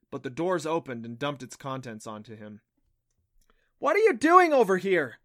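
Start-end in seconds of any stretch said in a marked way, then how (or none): 3.8-5.2 high, loud demanding question; raised-voice, indignant challenge; sharp demand. A narrator's reading. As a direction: demand an explanation, loudly. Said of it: "Clancy demanded".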